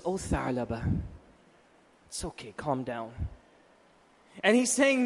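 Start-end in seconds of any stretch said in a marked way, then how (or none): 0.0-3.1 low, soft, soothing reassurance; calm a distressed child, hushed and low; gentle comforting. Retold or imitated. The Prophet comforts a crying boy, tenderly. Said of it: "Listen how he starts speaking".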